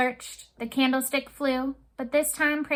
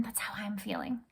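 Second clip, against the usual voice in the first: whisper; 2 semitones lower, 12 dB quieter than usual. Real, not acted. Teacher sharing suspense feeling.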